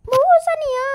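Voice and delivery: loud call across the room. Acted. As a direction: shout her name to get attention. Calling out for Bu Sania. (memanggil dengan keras)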